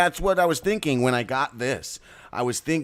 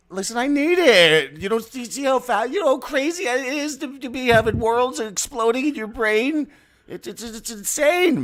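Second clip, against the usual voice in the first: very high, frantic outburst; frantic ranting; 13 semitones higher than usual. Acted. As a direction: rant wildly, pitched way up. Frantic riff about worlds exploding.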